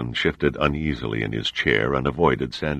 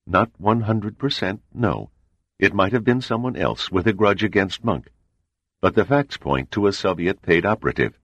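higher, measured voice of a composed spy chief; cool CIA agent; 7 semitones higher than usual. A narrator's reading. CIA man explains the murder to the admiral, composed. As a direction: lay out the facts coolly and evenly.

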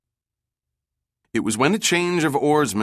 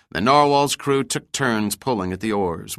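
usual speaking voice

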